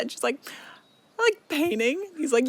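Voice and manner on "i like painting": chirpy. Retold or imitated. Feyre announcing hobby.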